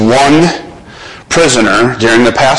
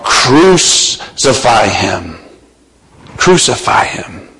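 ordinary talk

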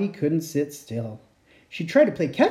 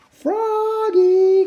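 higher, louder drawn-out call; 6 dB louder than usual. Acted. Mom calling Froggy from far, loud and high. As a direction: call him from the house. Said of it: "called his mom".

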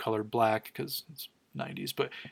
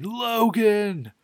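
a high, loud cry of his name; imitated scream; 9 semitones higher, 5 dB louder than usual. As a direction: scream his name in horror, much higher. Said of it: "you just hear Jean screaming".

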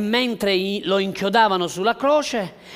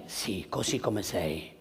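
soft reassurance; 9 semitones lower, 8 dB quieter than usual. Acted. Pastor gently reassuring a doubting listener, soft and low. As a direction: lower and much softer, tender answer. Answering tenderly.

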